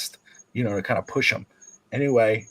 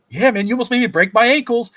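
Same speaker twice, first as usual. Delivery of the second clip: high, loud teasing boast; son taunting; 10 semitones higher, 7 dB louder than usual. Retold or imitated. Son taunts the other boy, high loud teasing. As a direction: brag like a cocky kid. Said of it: "Tristan goes".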